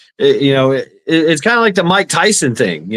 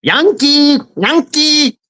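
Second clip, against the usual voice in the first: high singsong call of Yankee; mock Filipino call; 12 semitones higher, much slower than usual. Guest imitates locals calling him a Yankee. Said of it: "they would say something in their".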